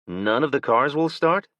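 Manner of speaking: None of the cars will start asked with a rise on start; Will's disbelieving question. A narrator's reading. Will echoes the woman in disbelief, rising question. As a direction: repeat it back as a question, rising at the end. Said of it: "Will asked".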